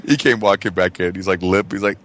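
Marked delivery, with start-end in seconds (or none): none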